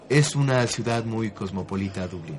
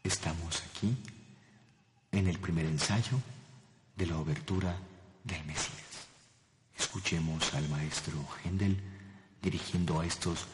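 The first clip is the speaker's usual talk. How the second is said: much softer, lower hushed reporter voice; 6 semitones lower, 11 dB quieter than usual. Acted. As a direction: murmur it like sneaking a recording. Whispering at secret rehearsal.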